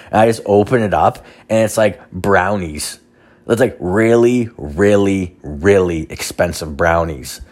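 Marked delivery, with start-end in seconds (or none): none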